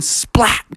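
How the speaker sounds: "splat" said like the noise itself; punched SPLAT. A narrator's reading. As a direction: hit "splat" as a sudden impact. Sound word for horn landing.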